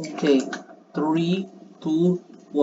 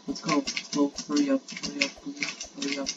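anxious urging; 2 semitones lower, 9 dB quieter, faster than usual. Real, not acted. Player pleading with the race to hurry, softly urgent. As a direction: urge it along under your breath.

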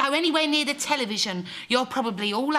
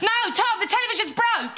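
high, urgent objection; alarmed, high-pitched excuse; panicked interruption; 7 semitones higher than usual. Acted. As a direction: cut in quickly to stop him. Hurriedly stops him switching television on.

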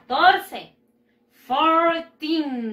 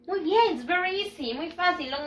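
ordinary talk

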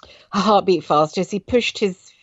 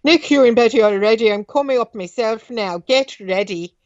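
a lightly voiced Irish father, a bit higher; Irish accent; 4 semitones higher, 4 dB louder than usual. Father calls to Nick from the stairs in an Irish accent. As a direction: give it the father's Irish lilt. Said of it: "Let's do that accent".

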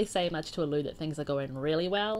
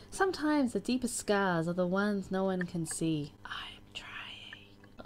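hushed, airy whisper of a lyric; slower than usual. Acted. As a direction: whisper it like a secret confession. Whispers song lyrics from a profile.

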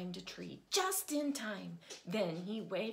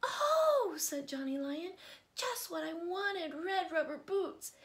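much higher, thrilled cry; delighted; 9 semitones higher, 5 dB louder than usual. A narrator's reading. Johnny Lion getting red rubber boots, thrilled and high. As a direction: squeal with joy.

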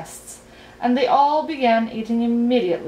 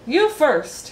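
loud, higher-pitched order; commanding; 6 semitones higher, 5 dB louder than usual. A narrator's reading. King picking Milo to speak first, commanding. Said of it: "he commanded".

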